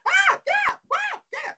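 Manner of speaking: very high breathy gush; imitating Monique.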